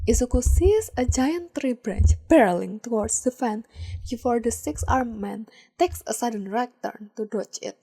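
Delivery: ordinary delivery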